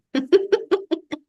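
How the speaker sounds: high, bubbling laugh. Real, not acted. Coach easing the client's worry, high chuckling laugh. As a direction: break into a high little run of laughter.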